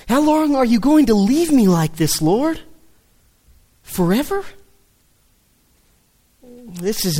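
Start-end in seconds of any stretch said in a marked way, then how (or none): 0.1-4.4 pained plea. Retold or imitated. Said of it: "you can hear the tone in his voice".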